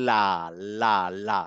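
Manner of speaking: লা with the tongue tip touching just behind the front teeth; light la. Shown as a demonstration. Teacher demonstrating Arabic lam to students with the tongue tip. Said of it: "জিভের ঠিক এই সামনের পার্টটা ... লেগে".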